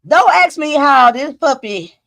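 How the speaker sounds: ordinary talk, nothing marked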